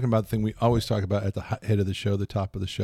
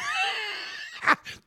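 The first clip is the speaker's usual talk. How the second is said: high burst of laughter; 6 dB quieter than usual. Real, not acted. Joke about peanut butter.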